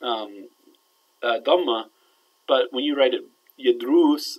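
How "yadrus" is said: "yadrus" with the last vowel held long and louder; long oo sound. Shown as a demonstration. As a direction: stretch "yadrus" into yad-rooos. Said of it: "it's an oo sound".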